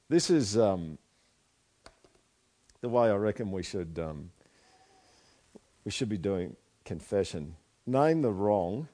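ordinary delivery

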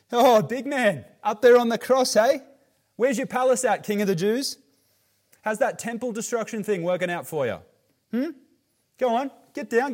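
loud, high jeering Aussie taunt; Australian heckler. Retold or imitated. Preacher voicing passers-by taunting Jesus in Australian speak, loud high jeer. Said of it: "paraphrase ... in Australian speak".

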